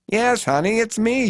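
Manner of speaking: put-on high, coy old-lady voice; shy admission. A narrator's reading. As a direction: admit it sweetly and shyly. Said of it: "she shyly admits".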